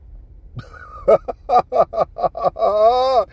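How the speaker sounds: high, loud burst of laughter; real cackling laugh that runs on. Real, not acted. Storyteller cracking up at her insult, laughing hard.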